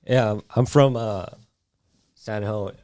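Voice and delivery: normal talking voice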